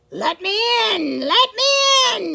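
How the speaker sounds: high, loud hungry howl. Acted. Hungry wolf howling at the pigs' door. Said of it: "he howled".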